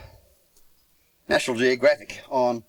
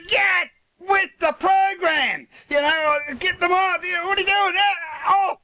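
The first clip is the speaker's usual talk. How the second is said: impatient yell. Retold or imitated. A mate too delicate with the razor, so he yells at him, impatient. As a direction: bark it out at a hesitant mate. Yelling at friend to cut.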